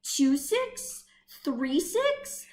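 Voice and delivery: ordinary delivery, nothing marked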